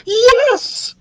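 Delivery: high squealed exclamation; very excited. A narrator's reading. Queen answers doubtful son, thrilled. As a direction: squeal it with glee. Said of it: "The queen was so excited".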